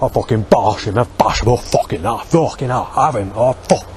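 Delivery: imitated Somerset accent with the R brought out; put-on Somerset and Dorset accent; dry Somerset accent. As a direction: mimic a sweary West Country local. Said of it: "they sometimes bring that R out in the word".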